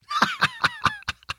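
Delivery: squeaky burst of laughter; high delighted laugh. Real, not acted. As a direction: crack up at the joke. Laughs at drawing of him eaten.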